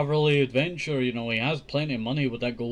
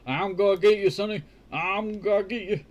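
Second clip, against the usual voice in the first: put-on a doddery grandpa chasing the boy; old grandpa; 6 semitones higher, 3 dB louder than usual. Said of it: "He's like a grandpa".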